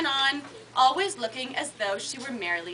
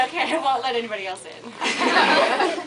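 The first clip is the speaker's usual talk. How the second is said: laughing; 2 semitones lower, 4 dB louder than usual. Real, not acted. Still laughing after line.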